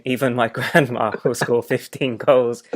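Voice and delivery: breaking into laughter mid-line; slowed, fighting back a laugh; laughing while reading. Real, not acted. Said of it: "I'm gonna try and read it without laughing".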